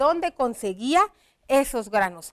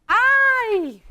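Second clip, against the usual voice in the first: high, loud ¡Ay! thrown out as a yelp; ant's cry of pain; 8 semitones higher, 6 dB louder than usual. Retold or imitated. Teacher acts out the tortured ant crying out to the class in a high, loud yelp. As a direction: let out a sharp, high ¡Ay! as if hurt. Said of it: "que ella está gritando".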